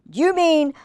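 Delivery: loud indignant snap; snapping. A narrator's reading. Fox snapping at the sergeant sitting on him, loud and indignant. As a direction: bite the words off, offended. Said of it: "snapped Foxy Loxy".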